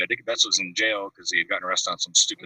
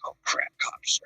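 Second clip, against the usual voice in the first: hushed, breathless alarm under the breath; retold panicked whisper; 4 dB quieter, much slower than usual. At his friend's house when a knock comes, a retold alarmed whisper. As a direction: whisper it like cops are at the door.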